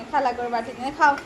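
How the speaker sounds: usual speaking voice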